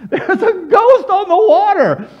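high, loud hammed-up cry; mock terror. Disciples seeing someone walk on water, mock terror. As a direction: play the frightened disciples, half laughing. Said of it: "goes with all the scary stuff".

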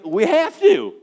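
determined. Retold or imitated. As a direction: plant your feet and insist. Determined reply to wife.

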